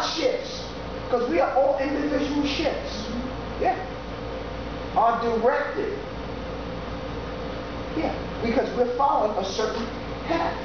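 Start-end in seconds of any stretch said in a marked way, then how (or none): none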